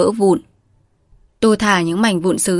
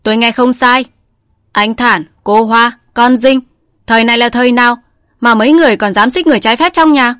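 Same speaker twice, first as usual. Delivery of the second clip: loud, accusing bark; shouting; 4 semitones higher, 6 dB louder than usual. A narrator's reading. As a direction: shout him down with outrage. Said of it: "Khuếnh quát". (quát lớn giận dữ)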